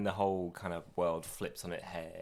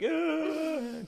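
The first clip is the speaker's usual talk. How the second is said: high, loud mock cry of fright; a high-pitched scared "ah"; frightened cry; 5 dB louder than usual. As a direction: cry out as if cowering from horror. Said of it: "we were just like".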